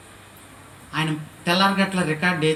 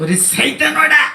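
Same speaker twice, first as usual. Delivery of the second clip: contemptuous insult; 11 semitones higher, 10 dB louder, slower than usual. Retold or imitated. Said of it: "ఏమన్నాడో తెలుసు నన్ను చూసి".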